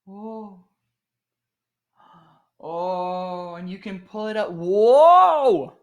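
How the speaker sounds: amazement building into a loud, high whoa; real awe swelling to a big shout; freaking out. Real, not acted. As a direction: marvel at a discovery, then burst out. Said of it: "I know I'm freaking out right now".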